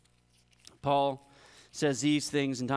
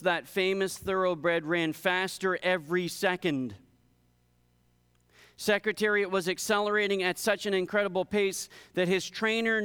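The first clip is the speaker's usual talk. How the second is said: raised pitch, driving sermon storytelling; illustration read aloud; 5 semitones higher than usual. A narrator's reading. Preacher telling the congregation of Secretariat's record run, illustration read aloud. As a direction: build up the wonder of the race.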